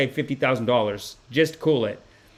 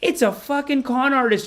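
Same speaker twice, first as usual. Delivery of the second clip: normal talking voice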